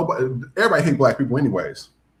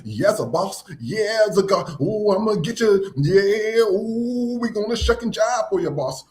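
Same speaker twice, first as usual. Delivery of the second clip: mocking eager-to-please voice, higher, drawn-out ooh's; sneering parody of fawning praise, pitched up; pandering shuck and jive; 8 semitones higher, slower than usual. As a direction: ham up a grovelling flatterer to ridicule him. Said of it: "The pandering and the".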